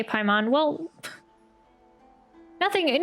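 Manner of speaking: ordinary talk, nothing marked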